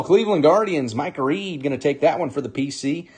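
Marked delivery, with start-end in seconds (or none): none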